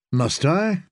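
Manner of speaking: cool, rising question; icy but understated retort; cold. A narrator's reading. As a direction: coldly challenge with a question. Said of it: "Littlefinger's voice had grown cold".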